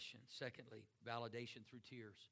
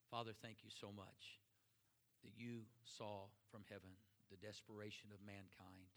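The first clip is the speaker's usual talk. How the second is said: closing prayer. Acted. Pastor leads closing prayer.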